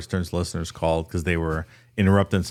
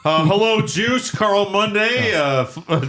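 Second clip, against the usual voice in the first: Carl Monday; 9 semitones higher than usual. Pretend. Reporter confronting O J at his door, high mock voice. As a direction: announce yourself like an ambushing TV reporter, pitched way up. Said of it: "sent Carl Monday up the front door".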